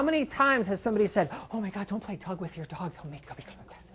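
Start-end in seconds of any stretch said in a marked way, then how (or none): 1.5-3.9 mock hushed warning. Mocking common tug-of-war myth.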